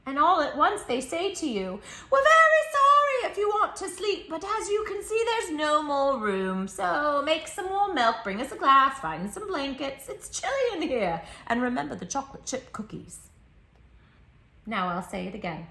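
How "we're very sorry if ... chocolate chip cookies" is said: high, loud polite-but-pushy animals; sweetly demanding guests; animals chorus. Acted. Animals asking the child for milk and cookies, high and sweet.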